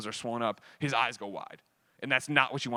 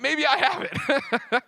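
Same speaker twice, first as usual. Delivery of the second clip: laughing; 13 semitones higher, 4 dB louder, much slower than usual. Retold or imitated.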